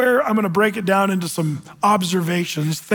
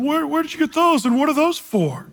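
put-on high, curious child's voice, a lot higher; little girl asking; 7 semitones higher than usual. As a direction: ask like an eager five-year-old. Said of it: "little Annie, five-year-old, asked".

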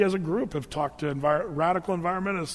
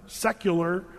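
normal talking voice

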